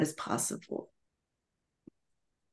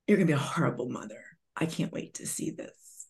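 lower, softer and fast, trailing to a breath; cruel mother; 3 semitones lower, 7 dB quieter, faster than usual. Retold or imitated. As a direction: sneer it fast and quiet like a scornful mom. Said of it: "And you know what my mom said?".

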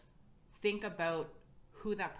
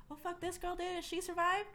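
high-pitched imitation of an astonished admirer; amazed onlooker. Scared onlooker seeing another woman succeed, high amazed voice.

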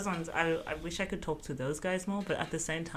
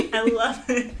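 laughing out the words; giggling at herself; 7 semitones higher, 12 dB louder than usual. Real, not acted.

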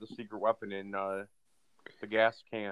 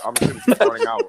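much higher, louder, fast carefree voice; carefree receiver voice; 13 semitones higher, 8 dB louder, much faster than usual. Retold or imitated. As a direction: play the carefree receiver, bouncy. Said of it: "So he's like".

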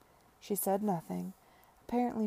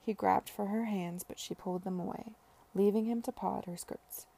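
ordinary delivery

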